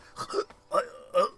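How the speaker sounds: dramatic gasp. Pretend. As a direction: gasp like you can't breathe. Pretends to breathe plastic.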